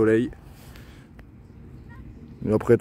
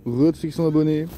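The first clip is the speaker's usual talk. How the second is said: cheerful greeting; 7 semitones higher, 4 dB louder than usual. Real, not acted. Cheery greeting back to viewers.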